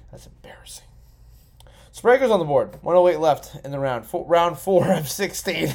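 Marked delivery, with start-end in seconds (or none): 0.1-0.8 a soft, breathy aside whisper. Real, not acted. Podcaster confides to his listeners in a whisper. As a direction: whisper it like a secret. Confides about getting drunk.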